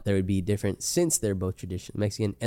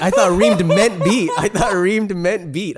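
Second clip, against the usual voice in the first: incredulous; 8 semitones higher, 7 dB louder, slower than usual. Real, not acted. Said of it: "repeats in disbelief, laughing".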